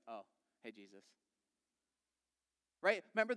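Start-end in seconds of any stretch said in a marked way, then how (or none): 0.1-1.0 low, hushed little hello; understated greeting. Acted. Mary to Jesus on recognizing him, understated soft greeting. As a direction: drop it right down, very soft.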